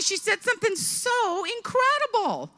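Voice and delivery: ordinary talk, nothing marked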